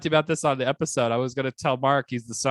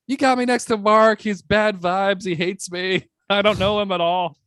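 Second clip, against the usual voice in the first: freaking out; 6 semitones higher than usual. Retold or imitated. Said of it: "I'm like".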